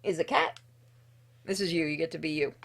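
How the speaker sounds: usual speaking voice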